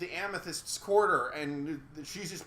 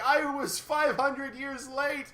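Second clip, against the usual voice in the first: mock tearful; 8 semitones higher, slower than usual. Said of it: "she's all crying".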